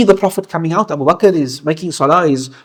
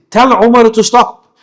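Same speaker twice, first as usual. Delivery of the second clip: sudden raised command, higher and louder; urgent command; 8 semitones higher, 6 dB louder than usual. Retold or imitated. Prophet hearing Umar lead, urgent raised order. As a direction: call out to stop it at once.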